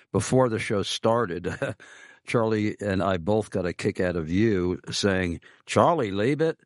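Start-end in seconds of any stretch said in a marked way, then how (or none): 5.7-6.6 higher-pitched mock Australian accent, a bit Cockney; bad Australian accent. Host teasing his Australian guest in a poor Australian accent. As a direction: ham up a clumsy Australian accent to tease Ken. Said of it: "are you trying to copy my Australian accent".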